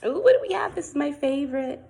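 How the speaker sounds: bright high ooh of delight; excited. Acted. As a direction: squeal with excitement at the new page. Excited page turn.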